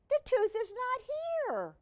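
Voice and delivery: alarmed. Acted. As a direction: sound worried and lost. Pete searching, alarmed.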